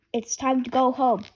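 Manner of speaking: ordinary delivery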